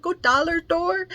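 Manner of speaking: put-on high little-boy voice saying "dollar door" for dollar store; imitating a toddler. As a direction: ask like a small boy mangling "dollar store". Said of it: "I always imitate him".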